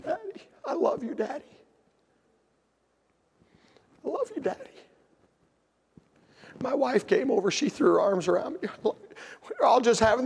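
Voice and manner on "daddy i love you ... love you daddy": soft, high, tearful little voice; imitated crying child. Spanked daughter hugging her father, imitated soft crying. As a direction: mimic a small girl whimpering her love.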